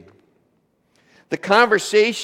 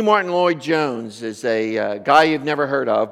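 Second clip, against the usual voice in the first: ordinary talk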